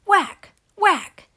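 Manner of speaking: punchy, loud Whack with a falling tune; loud sound effect. A narrator's reading. Narrator voicing the shovel hits, loud and punchy. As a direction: smack each Whack out like a cartoon hit.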